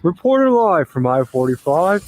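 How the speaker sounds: slow, lightly hammed-up on-the-scene reporter; playful TV reporter sign-on; mock news reporter. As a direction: pretend to open a live news report.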